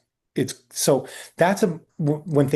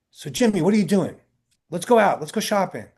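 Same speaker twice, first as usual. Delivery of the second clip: imitating an eager friend, higher-pitched; chummy invitation; 6 semitones higher than usual. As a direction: rope a pal into an outing. Actor calls, suddenly friendly.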